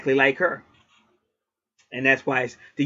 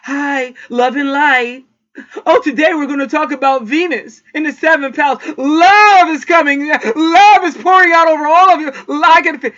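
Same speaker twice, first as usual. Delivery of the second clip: much higher and louder, sugary mock spiritual voice; mock love-and-light reader; 11 semitones higher, 7 dB louder than usual. Mocking other astrologers who speak to viewers in a high, louder sugary voice. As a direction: ham up a saccharine lightworker, stretching love. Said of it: "I'm not out here talking like this".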